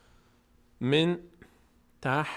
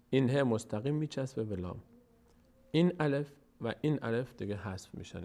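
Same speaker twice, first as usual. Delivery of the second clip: ordinary talk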